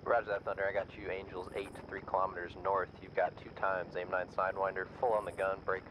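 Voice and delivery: crackly military radio. Real, not acted. Other player over game radio.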